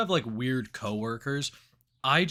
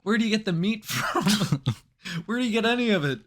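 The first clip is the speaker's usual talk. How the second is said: laughing disbelief; 8 semitones higher, 5 dB louder than usual. Real, not acted.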